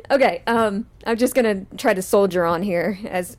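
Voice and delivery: ordinary delivery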